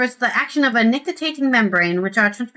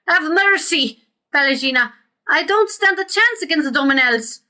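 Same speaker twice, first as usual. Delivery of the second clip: higher, pleading voice; lightly hammed-up plea; begging merchant; 6 semitones higher than usual. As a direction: beg for mercy, pitched up. Reads Verzano begging on his knees.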